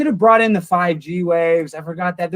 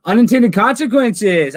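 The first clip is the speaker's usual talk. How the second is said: higher, louder and slow; excited announcement; 6 semitones higher, 6 dB louder, slower than usual. Acted. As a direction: announce the name with delight. Reading a super chat name.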